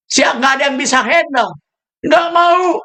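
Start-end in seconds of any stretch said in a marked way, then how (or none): 2.1-2.8 sharp, higher shouted refusal. Retold or imitated. Retold refusal of a church post.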